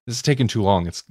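ordinary talk, nothing marked

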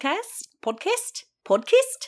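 squeaky-high attempts at New Zealand "Podcast"; hammed-up, questioning New Zealand accent; bad New Zealand accent. Shown as a demonstration. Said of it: "I can't do accents, you know".

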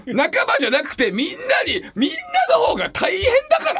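高い声で笑い混じりに反論; 呆れ笑いで言い返す; 笑いながら抗議. Real, not acted. (相方に笑いながら高い声で反論する)

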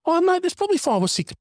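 dad retelling his reply, squeaking high; incredulous dad. Retold or imitated. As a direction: play up the dad's amazement. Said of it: "And I go".

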